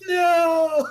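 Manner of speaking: long, loud, high mock wail; anguished cry. Player just shot round a corner, drawn-out anguished yell. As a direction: cry out in dramatic defeat. Retold scream on getting domed.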